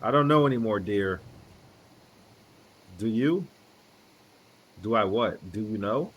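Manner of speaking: ordinary talk, nothing marked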